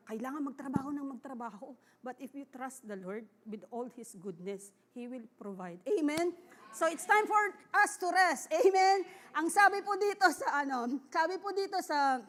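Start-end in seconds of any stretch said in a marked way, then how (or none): 5.9-12.2 loud, high call to the congregation; preaching loud. Real, not acted. Preacher calling church to rest, loud and high. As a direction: rouse the church to answer.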